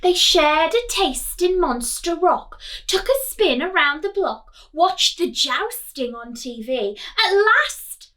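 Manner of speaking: put-on lively sing-song rhyme for children; children's picture book read-aloud; storybook reading. A narrator's reading. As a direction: read it bright and bouncy to the class.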